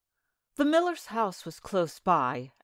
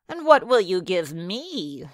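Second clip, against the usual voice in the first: gravelly sly hobgoblin; 3 dB louder than usual. A narrator's reading. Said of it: "said the hobgoblin".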